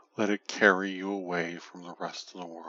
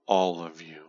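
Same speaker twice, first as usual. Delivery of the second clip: ordinary talk, nothing marked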